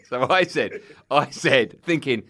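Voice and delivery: usual speaking voice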